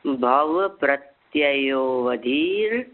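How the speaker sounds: drawn-out syllable by syllable; very slow recitation. Shown as a demonstration. Teacher reciting slowly so students can repeat. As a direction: spell the sutra out slowly for students. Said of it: "देखिए बहुत धीरे धीरे बोलता हूँ".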